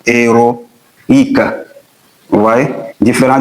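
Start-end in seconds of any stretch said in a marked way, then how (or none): 0.0-1.4 Héroïque slowly broken up as é-ro-ïque; Héroïque drawn out syllable by syllable; vowels read separately. Shown as a demonstration. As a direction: pull the O and I apart in Héroïque. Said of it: "on va lire ça séparément".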